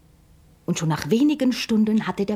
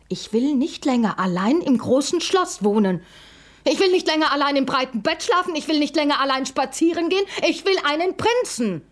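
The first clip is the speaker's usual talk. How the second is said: higher, faster repeated demand; shouting even louder; 9 semitones higher, 3 dB louder than usual. Acted. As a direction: yell it again, faster and more demanding. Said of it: "schrie die Prinzessin noch lauter".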